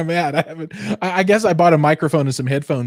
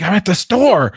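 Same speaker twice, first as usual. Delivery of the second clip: loud, higher mimicked reply; mock exclamation; 7 semitones higher, 6 dB louder than usual. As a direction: call it out as the friend's answer. Retelling a friend's text reply.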